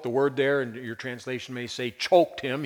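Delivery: ordinary talk, nothing marked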